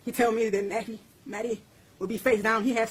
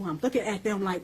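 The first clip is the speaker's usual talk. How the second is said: ordinary delivery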